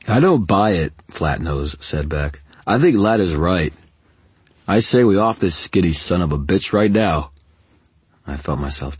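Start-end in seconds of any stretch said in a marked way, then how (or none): 0.1-0.9 loud, high, defiant henchman; defiant thug. A narrator's reading. Henchman refusing his boss's plea, loud defiance. 2.6-7.3 loud, higher, aggressive henchman; defiant thug. A narrator's reading. Henchman pressing to kill the captive, loud and aggressive.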